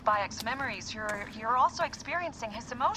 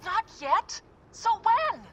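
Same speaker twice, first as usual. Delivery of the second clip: exasperated disbelief; 11 semitones higher, 6 dB louder, slower than usual. Acted. Arguing about reporting.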